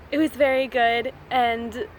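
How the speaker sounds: usual speaking voice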